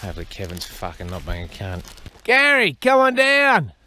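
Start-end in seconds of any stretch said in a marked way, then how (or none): 2.2-3.7 high, sustained, sing-out summons; calling out. Real, not acted. Feeder calling Gary over for food, high drawn-out call. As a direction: draw out each word high to call him over.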